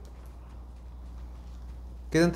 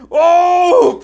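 loud high cry of triumph; victory shout; 12 semitones higher, 12 dB louder than usual. Real, not acted. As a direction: howl of triumph. Wins the battle. (grito de victoria)

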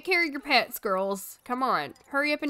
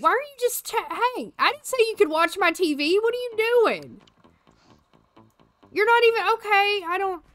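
much higher, mock scolding the dog; amused indignation; 9 semitones higher, 3 dB louder than usual. Real, not acted. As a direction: tease the dog for stealing the TV. Dog chilling watching her TV.

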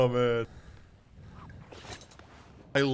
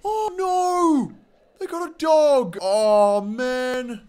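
dismayed cry; 13 semitones higher, 10 dB louder, much slower than usual. Real, not acted. Dismay at enemy dog.

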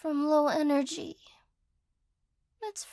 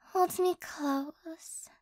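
slow breathy whisper; drawn-out intimate whisper; 4 dB quieter, much slower than usual. Acted. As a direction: whisper it slowly, very close. Cuddling, asks to be held.

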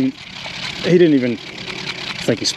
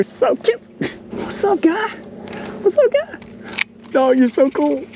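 cooing. Real, not acted. Angler cooing at cute otters, high baby talk. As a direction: coo at them like pets.

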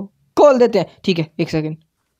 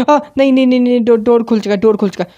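higher, louder panicked no no no; startled panic; 5 semitones higher, 4 dB louder, faster than usual. Real, not acted. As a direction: caught out, blurt it in alarm. Nun opens door, caught. (घबराकर ऊँची, तेज़ आवाज़ में)

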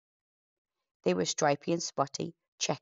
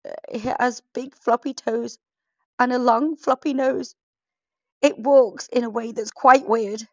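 higher, louder, nervous pirate voice; frightened pirate; 7 semitones higher, 8 dB louder than usual. Acted. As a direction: describe the monster in a panic. Pirate describes the monster.

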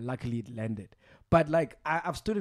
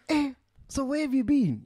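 curious son; 10 semitones higher than usual. Retold or imitated. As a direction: press him, half-believing. Mo Flava curious.

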